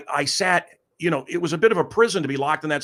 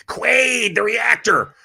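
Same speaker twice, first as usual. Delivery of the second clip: high, loud strained movie-creature cry; hammed-up creature calling for help; mutant twin voice; 9 semitones higher, 5 dB louder, much slower than usual. Joking about a calcified twin, put-on creature shout. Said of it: "like I got a, a, a calcified twin".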